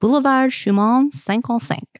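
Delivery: French address in a Southern American accent. Shown as a demonstration. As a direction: try the French, Southern twang and all. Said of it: "or as they say in French".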